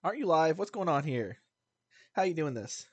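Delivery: normal talking voice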